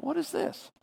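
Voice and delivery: high, hushed retold disbelief; a small, high-pitched baffled thought; mock bewilderment. Retells his baffled private thought.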